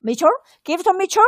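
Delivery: Mitchell and give to Mitchell said with a clear upward lift; rising tune. Shown as a demonstration. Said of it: "她在上扬她的音调啊".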